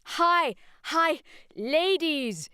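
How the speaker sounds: very high, loud yells; desperate warning yells; yelled warning. Acted. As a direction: yell the alarm into the trees. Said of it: "Yells and widening circles".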